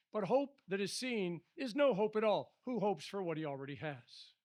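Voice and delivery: projected Bible reading; scripture reading. Acted. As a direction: read the verses out strongly to the congregation. Reads scripture aloud.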